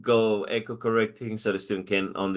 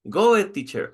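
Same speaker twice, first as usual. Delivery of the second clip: student voice; 9 semitones higher, 4 dB louder than usual. Shown as a demonstration. Student answers teacher in demo.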